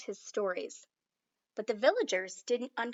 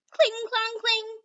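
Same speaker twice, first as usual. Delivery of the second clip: much higher, louder clang words; clanging swords; 11 semitones higher, 5 dB louder, slower than usual. Acted. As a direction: ring them out like steel on steel. Battle sounds resume.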